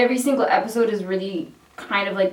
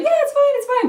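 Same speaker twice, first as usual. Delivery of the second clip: much higher, breezy it's fine; squeaky dismissive reassurance; 4 dB louder than usual. Retold or imitated. Speaker dismisses friend's worry, squeaky mock reassurance. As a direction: squeak it off like nothing.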